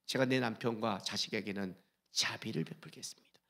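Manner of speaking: soft whispered vow to God; whispered prayer. Acted. Pastor voicing a believer's prayer, whispered. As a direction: breathe the promise like a private prayer. Prayer quote, then plain repeat.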